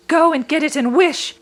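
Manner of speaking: put-on high, loud cry from an old woman quivering with excitement; excited cry. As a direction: urge him on, half shouting. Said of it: "cried the old woman, quivering with excitement".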